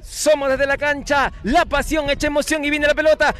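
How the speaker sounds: quickening live match call; play-by-play. Acted. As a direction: pick up pace as attack builds.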